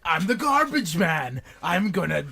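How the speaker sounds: over-the-top wrestler character, stretched words; hammed-up wrestler. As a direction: draw it out like a cartoon wrestling promo. Acting out wrestler with day job.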